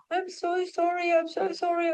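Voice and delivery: high, fluttery mock-embarrassed sorry; embarrassed apology. Retelling the fainting woman's apology, high and embarrassed. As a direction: imitate her flustered apology. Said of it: "She was so embarrassed".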